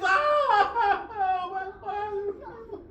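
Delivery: high wailing cry that sinks to a sob; the girl cries out over her father's head; anguished cry. Acted. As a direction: let the cry break and fall away. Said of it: "And she screams".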